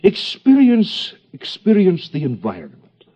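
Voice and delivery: hammed-up German analyst accent, the first word slow and high; mock German accent. Lecturer parodies a psychoanalyst instructing a patient, mock German accent. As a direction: drag out the first "Experience" in a German accent, then drop back into the accent. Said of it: "the proper accent of psychoanalysis".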